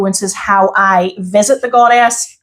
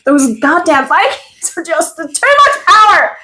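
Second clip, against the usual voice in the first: mock dramatic; 9 semitones higher, 3 dB louder, slower than usual. Mock dramatic about Viking music.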